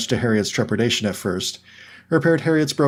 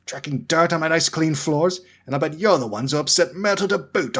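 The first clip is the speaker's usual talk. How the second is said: grumpy caretaker; 6 semitones higher than usual. A narrator's reading. Caretaker scolds the first years.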